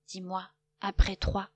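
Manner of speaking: ordinary delivery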